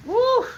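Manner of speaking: high excited whoop; excited woo. Real, not acted. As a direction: whoop with glee. Excited about jokes.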